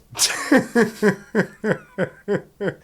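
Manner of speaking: sudden high-pitched cackle at himself; high burst of laughter. Real, not acted. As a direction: crack up at your own joke. Laughs at own aside.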